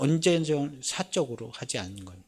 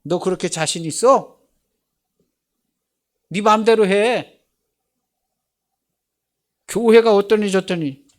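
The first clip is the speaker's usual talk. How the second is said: acted loud, higher-pitched dare; loud challenge; 6 semitones higher, 10 dB louder than usual. Preacher confronting someone cursing Jesus, loud acted challenge. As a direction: call the scoffer's bluff at high volume.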